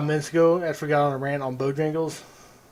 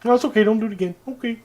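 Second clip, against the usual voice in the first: lightly put-on Canadian accent, higher; mild, forgiving Canadian voice; Canadian cop; 6 semitones higher, much faster than usual. Canadian cop lets an offender off, forgiving Canadian lilt. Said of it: "they're arresting people".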